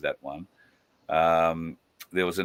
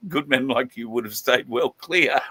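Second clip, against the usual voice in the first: 1 laughing aside; 5 semitones higher, 3 dB louder than usual. Real, not acted.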